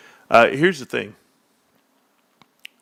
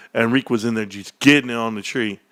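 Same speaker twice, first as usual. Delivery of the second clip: ordinary talk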